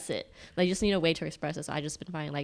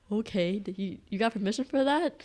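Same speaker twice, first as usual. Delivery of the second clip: higher, increasingly loud disbelief; mock incredulity; 6 semitones higher, 7 dB louder than usual. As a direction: act amazed and a bit jealous, building louder. Acting out jealous reaction to friends.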